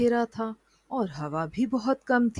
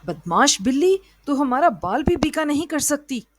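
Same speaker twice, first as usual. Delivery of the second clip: loud defiant shout, pitched up; 5 semitones higher, 7 dB louder than usual. A narrator's reading. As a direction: yell up at the cat from the pit. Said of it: "नीमू ने चिल्लाकर कहा".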